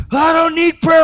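high, loud shout of refusal; retold angry refusal. Biker refusing the preacher's prayer, high loud shout. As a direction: throw the offer back in his face.

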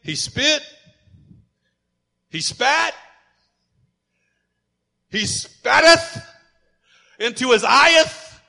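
a playful nod to archaic Bible language; mock King James Bible reading. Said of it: "Whether you want to say".

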